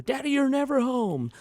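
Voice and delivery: put-on high, whiny kid voice, hurt and accusing; child's complaint. Young child melting down at dad, high and whiny. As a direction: play the little kid wailing at dad.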